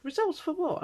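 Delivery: very high, blank nurse voice; mimicking clueless nurse. Woman imitating the clueless nurse, very high. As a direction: play the nurse as totally clueless.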